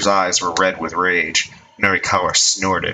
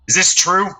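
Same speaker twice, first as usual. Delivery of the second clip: high, sharp gang leader's question; lightly voiced angry boss; stern demand; 10 semitones higher, 5 dB louder than usual. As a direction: demand the truth.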